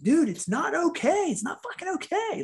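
very high-pitched, strained outburst; acted high-pitched frustration; exasperated protest. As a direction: act out snapping back at empty comfort, voice way up. Acted protest at being comforted.

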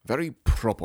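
lightly put-on Imperial British accent; proper British accent. Fan mimicking Lucius Malfoy, very proper British accent. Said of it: "royal, proper British accent".

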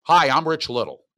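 punchy showbiz self-introduction, put on; Rich Little. Shown as a demonstration. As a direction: do an old TV impressionist's greeting. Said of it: "do an impression of Rich Little".

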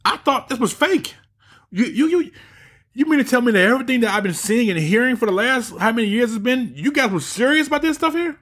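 mocking Joe Rogan. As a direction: play the stunned podcaster, pitch way up. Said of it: "He's like".

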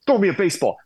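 calling out. Retold or imitated. Teammate calls out for a ball.

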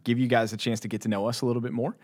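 usual speaking voice

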